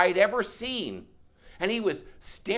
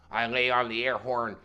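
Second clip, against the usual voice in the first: usual speaking voice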